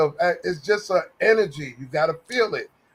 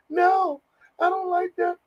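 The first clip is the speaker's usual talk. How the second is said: mock emotional guy; 11 semitones higher than usual. Mocking big guys who won't fight, in a high whiny voice. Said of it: "And they'll get emotional".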